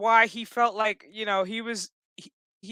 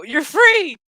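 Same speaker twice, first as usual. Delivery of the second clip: higher, louder mock cry of liberation; jubilant shout; 7 semitones higher, 8 dB louder than usual. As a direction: call it out like a triumphant rescuer. Retelling rescuers' cry of freedom.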